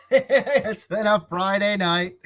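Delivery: slow, laughing through the words. Real, not acted. To cohost winding down, laughing slowly. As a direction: chuckle your way through it. Laughing wind-down after the rant.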